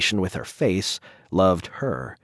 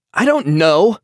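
panicked yell, high and louder; 9 semitones higher, 7 dB louder than usual. A narrator's reading. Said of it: "he yelled at her".

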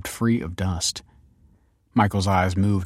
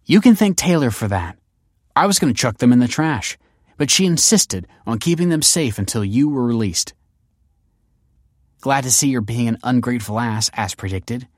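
higher, louder, exasperated daughter; arguing daughter; 4 semitones higher, 5 dB louder than usual. A narrator's reading. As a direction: push back hard at your father.

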